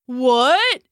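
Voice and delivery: muffled from behind a door; calling through a closet. Acted. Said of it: "came a muffled voice from the closet".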